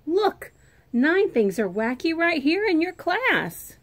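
high, loud urgent call; yelled. Acted. Child yells to Miss Bass about the wacky class. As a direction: yell it to the teacher. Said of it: "I yelled to Miss Bass".

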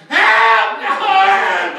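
high cry for help dropping back; mock screaming. Man in desperate trouble in the car, mock screaming. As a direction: act out a desperate scream without full volume. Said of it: "I'm screaming, crying".

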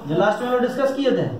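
ordinary delivery

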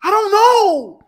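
high, loud mock wail; hammed-up bawling kid outburst; crying kid. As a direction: imitate a child wailing in frustration. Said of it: "I was a kid and I cried".

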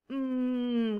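Mm as a soft hum with closed lips; teacher showing the sound of m; letter sound. Shown as a demonstration. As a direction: hum Mm gently through the nose. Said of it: "¿Cómo suena la M?".

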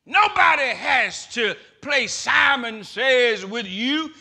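preaching shout. Acted. Preacher at full force.

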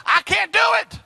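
sudden high cry of inadequacy; a preacher crying out his weakness; exclaimed. Retold or imitated. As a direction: blurt it out high and loud. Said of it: "I said".

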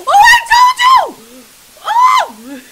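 high, drawn-out loud yell; startled shout. Real, not acted. Player proved right by the game, shrieking. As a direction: yell it out in alarm. Startled by what was predicted.

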